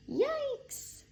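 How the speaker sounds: very high startled yelp; a tiny mouse jumping in fright. A narrator's reading.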